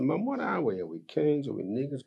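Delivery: put-on high, bewildered child questioning; anxious child asking. Son asking his mum what they are: high, bewildered. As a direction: ask like a hurt young man.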